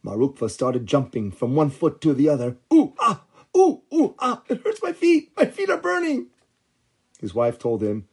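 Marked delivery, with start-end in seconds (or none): 2.7-6.3 high, squealing ouch voice; burning feet yelps. Acted. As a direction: yelp high as the feet burn. Hot oven burns his feet.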